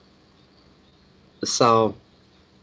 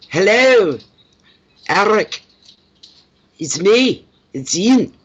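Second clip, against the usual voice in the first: high, loud Glasgow phone hello; loud Scottish greeting; 8 semitones higher, 6 dB louder, slower than usual. Retold or imitated. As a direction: call out like a Scot on the phone. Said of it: "as Scottish as they come".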